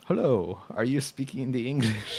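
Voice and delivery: hammed-up Eastern European photographer; Eastern European accent. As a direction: approach a stranger in mock Eastern European English. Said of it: "And I wanna do that".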